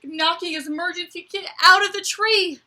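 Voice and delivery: high, loud alarmed narration; dramatic alarm. Acted. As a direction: tell the disaster with rising alarm. Squirrel drops kit in panic.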